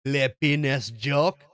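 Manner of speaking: mock French accent. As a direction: say it like a hammy Frenchman. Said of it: "You sound French".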